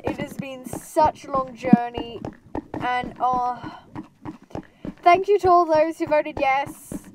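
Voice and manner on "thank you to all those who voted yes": excited. Real, not acted. Emotional thanks.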